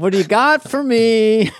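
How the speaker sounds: high, loud imitation of pleading; hammed-up loud wheedling; mock pleading. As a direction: badger the writers playfully. Acts begging writers for material.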